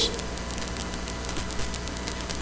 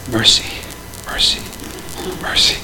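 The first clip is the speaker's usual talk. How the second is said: muttered under breath; 4 semitones lower, 8 dB quieter than usual. Retold or imitated. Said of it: "under my breath going".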